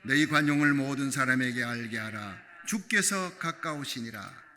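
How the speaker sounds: loud, measured reading of the verse; scripture recitation. Shown as a demonstration. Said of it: "자, 오 절 시작".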